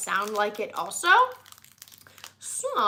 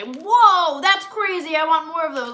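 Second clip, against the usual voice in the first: higher, louder wowed reaction; mock amazement; 4 semitones higher, 5 dB louder than usual. Taster imagining an amazing snack, excitedly wowed. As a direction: act out a rave review.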